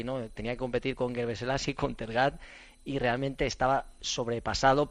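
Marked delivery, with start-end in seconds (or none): none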